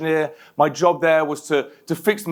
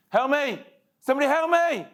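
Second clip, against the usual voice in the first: desperate call; 11 semitones higher than usual. Retold or imitated. Retold cry after crash.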